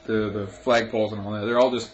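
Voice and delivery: ordinary delivery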